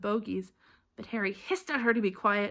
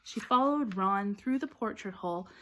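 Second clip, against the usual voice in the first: ordinary delivery, nothing marked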